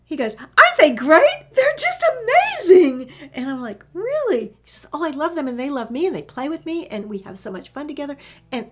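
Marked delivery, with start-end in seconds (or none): 0.4-3.1 very loud, high, drawn-out raving; ecstatic gushing. Retold or imitated. Dog gushing about the teenagers. 3.9-4.5 high, loud incredulous question; match the excitement with amazement; surprised. Retold or imitated. Her surprised reply to dog's raving. 4.9-8.7 fast, louder, higher rush of affection; excited gushing. Retold or imitated. Dog gushing on about teenagers.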